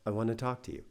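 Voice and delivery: soft, hushed mock coaxing; quiet coaxing parent. Parent coaxing a child before the yelling, mock quiet. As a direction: imitate a parent luring a child closer quietly. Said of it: "kind of be quiet and quiet".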